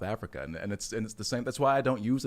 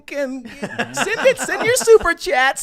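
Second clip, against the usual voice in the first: very high, slow, loud falsetto through laughter; squeaky falsetto; 9 dB louder, slower than usual. Pretend. Plugging super chats in a mocking falsetto while laughing. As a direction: hammer the plug in a silly squeal. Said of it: "I'll just work like this".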